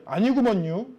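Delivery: 충청도 사투리로; Chungcheong accent. Shown as a demonstration. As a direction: say 아니구먼요 like a Chungcheong farmer. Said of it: "아, 이건 충청도인가요?".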